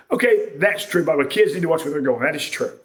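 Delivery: normal talking voice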